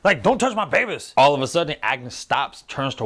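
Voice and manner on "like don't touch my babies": high-pitched mock protective outburst; hammed-up high panic over the babies; overprotective. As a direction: imitate a fussing, overprotective dad. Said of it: "gets a little overprotective".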